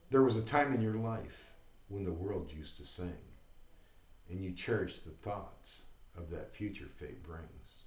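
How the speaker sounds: unhurried verse, pausing after each phrase; measured recital sinking gently at each close; poem recitation. Acted. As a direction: recite the poem, line endings dropping low and soft.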